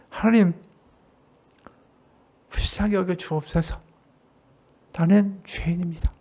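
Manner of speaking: soft, hushed plea for mercy; quiet humble prayer. Acted. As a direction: murmur it like a newcomer confessing. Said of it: "와서 이렇게 얘기해요". (조용하고 겸손한 참회 기도)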